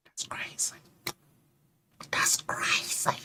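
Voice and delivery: breathy whispered "That's crazy."; whispered aside. Real, not acted. Muttering to self about the men's double standard, whispered. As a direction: whisper it under your breath, dismayed.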